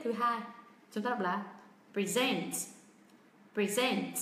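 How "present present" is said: "present" as pri-ZENT, weight on the last syllable; stress on second syllable. Shown as a demonstration. Teacher models the verb form, second-syllable stress on "present". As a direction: keep "pre" light and stress ZENT in "present". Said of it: "trọng âm lại rơi âm tiết".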